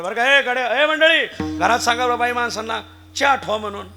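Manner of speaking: loud call across the house; loud master's command. Retold or imitated. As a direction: call out an order to the servant. Master calling to servant.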